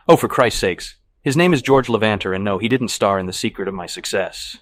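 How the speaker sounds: loud, exasperated outburst; fed up. A narrator's reading. Man correcting his nosy date about his friend, fed up. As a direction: snap out the answer, clearly fed up. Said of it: "then fed up".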